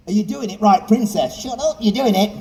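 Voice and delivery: ordinary delivery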